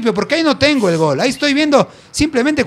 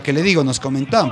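ordinary talk

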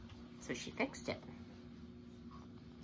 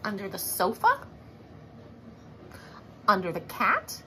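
usual speaking voice

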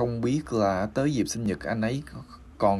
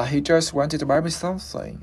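whole sentence glided through without a break, pitched higher; smooth and linked; 6 semitones higher than usual. Shown as a demonstration. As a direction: run "just wanted" together smoothly in one go. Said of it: "cho nóượt mà".

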